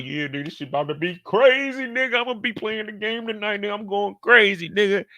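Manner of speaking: hyping himself up to his dog; hyped talk to the dog. Retold or imitated. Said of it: "I'm talking to my dog".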